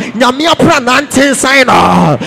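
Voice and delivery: impassioned, loud sermon delivery; full-force preaching. Real, not acted. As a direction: preach it out to the congregation.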